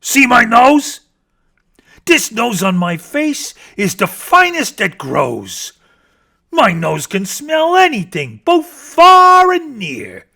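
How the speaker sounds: loud, slow bragging bear; boastful bear. Acted. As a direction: show off the nose grandly. Bear voice held.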